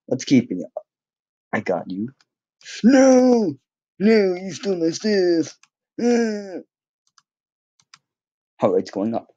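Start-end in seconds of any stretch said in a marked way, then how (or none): 2.8-6.6 high-pitched wail of protest; mock outrage. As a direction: cry out high in mock despair at being robbed. Game rival steals his stuff.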